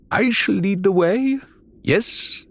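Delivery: high, pompous offer; grandly pleased Faisal. A narrator's reading. Faisal claims the lead from his friends, high and pompous. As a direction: relish being in charge.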